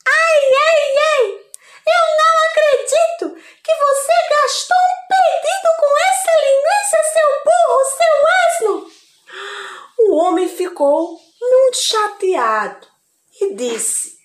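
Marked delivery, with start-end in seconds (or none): 0.1-8.7 high, loud scolding wife; furious wife. Acted. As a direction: yell at the husband, outraged. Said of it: "a mulher ficou furiosa".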